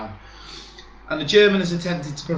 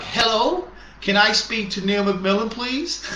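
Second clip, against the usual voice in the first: light imitation of an Australian caller, rising and higher; a hint of an Australian phone voice, polite and sing-song; Australian accent; 5 semitones higher, slower than usual. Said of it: "She sounded Australian".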